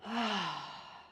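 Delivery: long relieved sigh out; satisfied exhale. Shown as a demonstration. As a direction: let out a deep sigh of relief. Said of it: "take a really good deep sigh".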